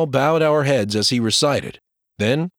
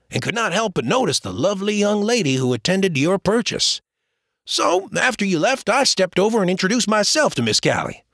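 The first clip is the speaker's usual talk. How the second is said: higher, playful man's voice; teasing James; 7 semitones higher than usual. Acted. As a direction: tease him like an old friend.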